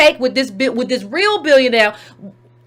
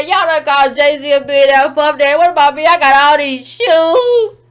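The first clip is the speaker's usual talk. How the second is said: mock [name] begging; 3 semitones higher, 5 dB louder than usual. [name] pleading with Forbes, loud whiny mock begging. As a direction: beg Forbes like a spoiled child. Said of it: "[name] out here begging Forbes".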